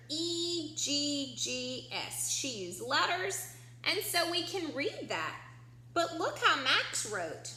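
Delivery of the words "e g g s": each letter said slowly and separately; spelled out slowly. Shown as a demonstration. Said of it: "look how nicely she wrote that word".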